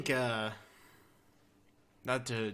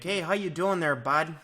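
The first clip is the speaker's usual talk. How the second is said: raised, louder friendly Canadian; Canadian accent; 6 semitones higher, 7 dB louder than usual. Acted. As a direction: put on a folksy Canadian accent. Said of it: "have to have the right voice".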